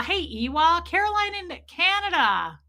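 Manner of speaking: ordinary talk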